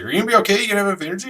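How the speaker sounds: high-pitched, concerned checking-in; lightly acted wifely concern; imitating concerned wife. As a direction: ask high, as a worried wife would. Retells wife's worried check.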